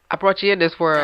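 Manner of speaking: lightly voiced scolding parent; mock parental warning; stern parent line.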